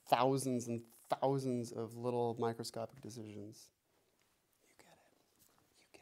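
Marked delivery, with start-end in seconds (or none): 4.7-5.9 hushed aside to the student; whisper. Real, not acted. Teacher whispering to the student mid-lesson. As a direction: drop to a whisper, just between us.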